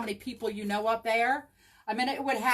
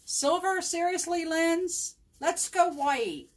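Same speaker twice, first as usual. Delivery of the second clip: incredulous; 9 semitones higher, slower than usual. Real, not acted. Mock outrage at friend's color choice.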